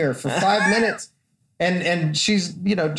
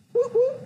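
high mock car-alarm chirp; alarm chirp imitation.

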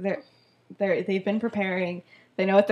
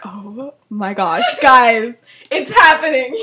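giddy, building to loud; so excited; 8 dB louder, slower than usual. Retold or imitated. James believes the fake confession, giddy excitement. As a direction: imitate a thrilled onlooker, laugh breaking in. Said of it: "He's so excited".

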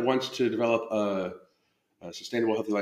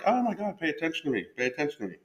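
voicing the kitten; 5 semitones higher, 3 dB quieter, faster than usual. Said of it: "she's just like".

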